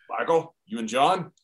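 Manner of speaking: lightly imitated boss, low and quiet; Vince. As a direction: deliver the boss's rebuke softly and low. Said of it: "he comes on and he goes".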